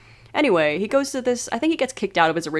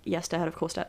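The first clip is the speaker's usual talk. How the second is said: softer ironic repeat of the son's promise; knowing quiet echo; 3 semitones lower, 9 dB quieter than usual. Retold or imitated. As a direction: say it again under your breath, knowing it's a lie. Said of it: "you know where this is going".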